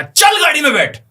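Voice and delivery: high, loud barked command; attacker's shout. Retold or imitated. Attacker grabbing hair in a drill shouts the order. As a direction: yank them toward the car. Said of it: "बाल पकड़ के बोलेगा".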